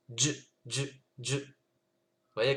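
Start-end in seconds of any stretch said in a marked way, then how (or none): 0.1-1.4 Dj, dj: consonne coupée net, aucune voyelle; stopped dj. Shown as a demonstration. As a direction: stopper le son juste après dj. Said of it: "On vient stopper". (dj sec, sans voyelle)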